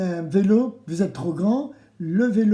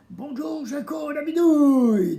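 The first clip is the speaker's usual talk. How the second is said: put-on children's reply; 8 semitones higher, 4 dB louder than usual. Shown as a demonstration. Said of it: "vous devez me répondre".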